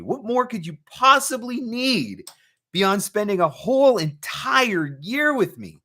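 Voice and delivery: high, slow mock-offended boast, playful; milk the fake outrage like a gift-giver; mock grandiose. Host teasing Suzanne that his time beats prizes, mock indignant.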